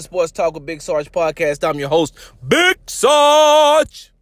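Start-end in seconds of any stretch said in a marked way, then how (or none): 2.5-4.1 slow, loud, high announcer voice; big show intro; announcer drawl. Acted. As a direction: stretch the name out like a ring announcer. Host name drawn out.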